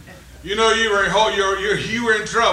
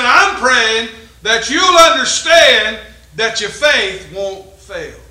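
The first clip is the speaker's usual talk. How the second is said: slow preaching; 6 dB louder, much slower than usual. Acted. Slow preaching of Jesus' words.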